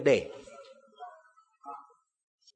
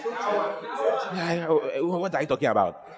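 mock disbelief; 4 dB quieter, slower than usual. Mimics doubting listener.

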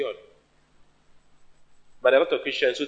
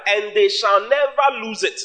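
high, slow, insistent declaration; emphatic proclamation; 7 semitones higher, slower than usual. Real, not acted. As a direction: stretch "never" with raised pitch. Repeats the promise for emphasis.

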